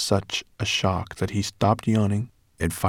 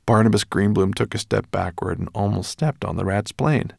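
ordinary delivery, nothing marked

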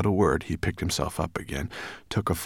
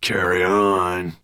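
slow, loud growl; 7 dB louder, slower than usual. A narrator's reading. Dragon ordering the tale onward, growling slowly. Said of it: "growled Nettlebrand".

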